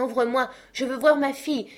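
put-on high, loud old father calling through a door, rushing; frantic father shouting at the locked door; desperate cry. A narrator's reading. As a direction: beg to be let in. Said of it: "s'écriait".

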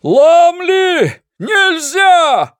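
desperate high shout. Acted. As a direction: yell after him in alarm. Narrator shouts warning at Lumley. (отчаянный крик)

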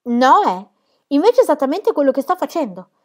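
più alta e forte, spavalda; esuberante. Acted. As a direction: sfoggia le bugie con orgoglio. Pippi ammette le bugie, esuberante. (Pippi che si vanta delle sue bugie, acuta e forte)

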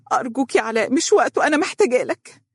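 high, trembling plea. A narrator's reading. Wife begs her husband to listen, voice trembling. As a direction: implore him urgently. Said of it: "قالت بصوت مرتجف".

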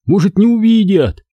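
ordinary delivery

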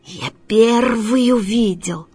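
slow, stretched cat snarl; nasty drawn-out cat retort; spiteful hiss. Acted. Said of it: "в ответ злобно шипит".

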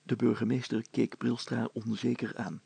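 plain reading voice

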